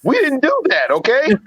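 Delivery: high, sassy "ooh, no" denial; mock protest. Joking denial to the movie scene, high and sassy. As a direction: play it as a prissy high-pitched objection. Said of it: "I'm like".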